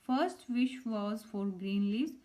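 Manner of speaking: reading each word out slowly and clearly; slow dictation. Shown as a demonstration. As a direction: spell it out for pupils, unhurried. Teacher reads worksheet answer.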